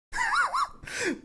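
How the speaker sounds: high-pitched wheezy laugh; real squeaky laughter. Real, not acted. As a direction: crack up in a thin, high wheeze. Cracking up at own bit.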